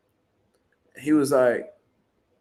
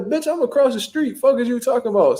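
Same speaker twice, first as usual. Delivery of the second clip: high-pitched mock indignation; imitated arguing; 9 semitones higher than usual. Stepdad argues back at the officer, imitated indignation. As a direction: play his outraged comeback, pitch way up. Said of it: "So they arguing back and forth".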